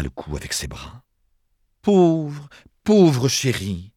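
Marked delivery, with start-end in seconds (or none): 1.8-3.9 high, slow, drawn-out pity; effusive pitying exclamation; compassionate cry. A narrator's reading. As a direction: gush with pity over a poor darling. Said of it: "s'écria-t-elle dans un élan de compassion".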